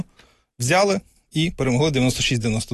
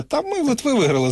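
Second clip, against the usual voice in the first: much higher, proud cry of a win; triumphant quote; 11 semitones higher than usual. Retold or imitated. Fan recalls the win over Lithuania, triumphant high cry. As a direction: boast like a fan who just beat Lithuania. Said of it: "в тебе залишається відчуття".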